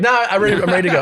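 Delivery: high, loud and rushed refusal; eager to leave. Retold or imitated. Refusing her breakfast offer, high and urgent. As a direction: blurt it out, desperate to get away.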